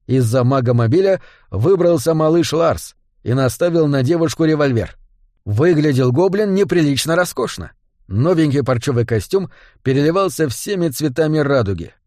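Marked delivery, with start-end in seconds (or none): none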